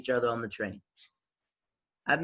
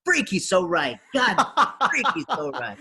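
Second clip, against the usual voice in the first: laughing; 7 semitones higher, 4 dB louder than usual. Retold or imitated. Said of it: "I was like".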